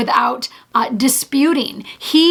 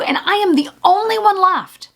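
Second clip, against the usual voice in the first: higher, pleading complaint; whiny Elijah; 6 semitones higher than usual. Retold or imitated. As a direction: mimic a self-pitying prophet.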